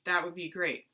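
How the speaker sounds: ordinary talk, nothing marked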